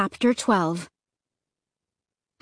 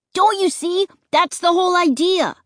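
higher, eager insistence; excited cry; 9 semitones higher, 4 dB louder than usual. Acted. Luke eagerly explaining the scare, excited. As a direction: convince them with excitement. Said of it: "Luke cried".

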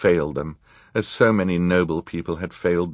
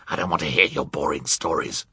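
scornful villain snarl; 2 semitones lower than usual. A narrator's reading. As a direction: snarl it at him. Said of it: "Count Olaf snarled".

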